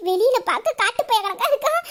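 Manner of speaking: ordinary delivery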